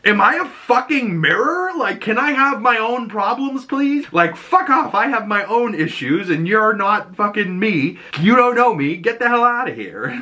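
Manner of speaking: hammed-up furious rant at a stranger; mock blow-up. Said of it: "Harcourt kind of blows up at her saying".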